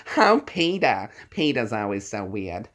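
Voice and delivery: nasal, slow whine that drops at the end; drawn-out nasal cartoon wife; Lois. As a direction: talk through a clogged nose, stretching the first words. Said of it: "Lois, I can do".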